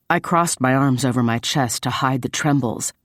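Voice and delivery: ordinary delivery, nothing marked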